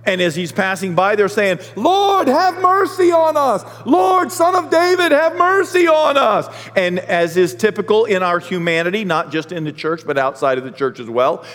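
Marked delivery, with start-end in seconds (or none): 1.8-6.5 high, loud pleading cry to the Lord; desperate crying out. Acted. Blind men crying out to Jesus for mercy, high and loud. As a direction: beg for mercy at the top of the voice.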